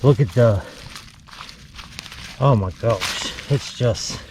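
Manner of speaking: breathless, gasping between phrases; out of breath. Real, not acted. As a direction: still winded, gulping air. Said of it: "Sorry, I'm a little out of breath".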